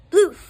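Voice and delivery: booming spell sound; loud magic bloof. Acted. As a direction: shout the magic noise.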